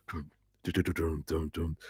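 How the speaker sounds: low, hushed imitated "dum dum" noises; quiet mock creature noises; spider stopping. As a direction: voice the spider's halt in soft, low nonsense syllables. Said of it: "he stops, and he's like".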